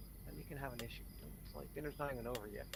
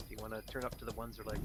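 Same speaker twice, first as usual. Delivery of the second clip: ordinary talk, nothing marked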